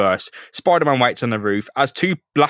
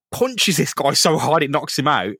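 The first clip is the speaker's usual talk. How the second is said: usual speaking voice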